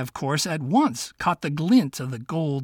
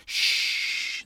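whispered shh. Acted. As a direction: hush him secretly. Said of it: "the fox whispered".

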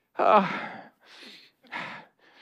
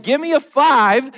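joyful call; 6 semitones higher, 3 dB louder than usual. Shown as a demonstration. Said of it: "A joyful noise sounds like".

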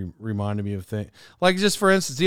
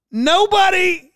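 high, slow, drawn-out "Nobody"; exasperated outcry; 14 semitones higher, 5 dB louder, much slower than usual. Real, not acted. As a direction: stretch it out in disbelief. Exasperated outburst at wife's word.

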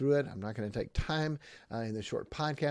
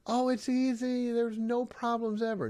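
high, loud mocking singsong; mock naive cheer; 13 semitones higher, 5 dB louder than usual. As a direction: parody the ones who say it's easy. Mocking naive view, then "No.

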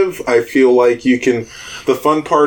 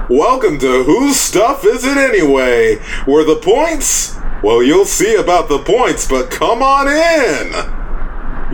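higher, drawn-out game show welcome; booming host voice; 4 semitones higher than usual. Acted. Satyr host welcomes the party to a bizarre game, booming announcer voice. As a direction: announce it grandly, stretching out the final words. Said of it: "you hear a loud voice boom".

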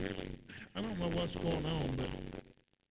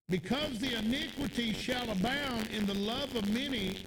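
preaching; 4 semitones higher, 3 dB louder than usual. Acted. Reading scripture in sermon.

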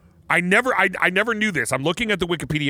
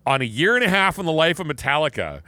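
normal talking voice

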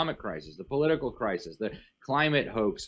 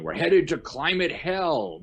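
mock doom prophecy; 7 semitones higher, 4 dB louder, slower than usual. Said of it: "saying, um".

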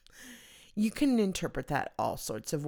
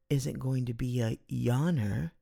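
usual speaking voice

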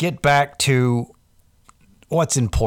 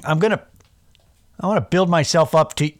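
ordinary talk, nothing marked

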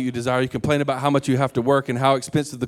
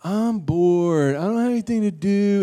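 put-on higher, drawn-out kid's whine; whiny kid; 8 semitones higher than usual. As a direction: whine it like a bored child. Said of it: "they'll come home week one".

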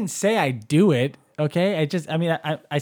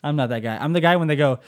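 normal talking voice

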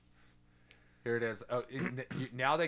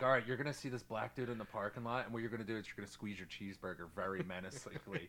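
hushed, conspiratorial mock instructions; low mock coaching, as if whispering a plan; mock director's orders; 7 semitones lower, 9 dB quieter than usual. Mocking the director telling actors how to act, hushed and conspiratorial. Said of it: "they're like".

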